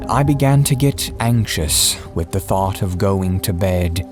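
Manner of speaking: plain reading voice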